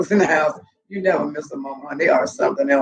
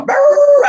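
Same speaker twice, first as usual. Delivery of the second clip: loud, high howl; imitated dog howl; 8 dB louder than usual. Dogs answering the wolf, loud imitated howling. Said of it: "They gonna go".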